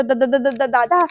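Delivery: stuttering on the first syllable before the word; scared man stammering to his elder brother; nervous stammer. Acted. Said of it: "ततपप व्हायला सुरू झाले". (घाबरून अडखळत बोलणे)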